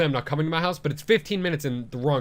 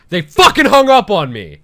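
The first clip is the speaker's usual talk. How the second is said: loud, indignant outburst; outraged; 6 semitones higher, 13 dB louder than usual. Real, not acted. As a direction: blurt it out, furious and louder. Outraged at being hung up.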